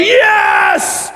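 high, loud drawn-out cheer; hammed-up yell of victory; mock jubilation. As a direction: shout it like a triumphant parent. Acted parent cheering kid at church.

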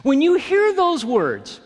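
ordinary delivery

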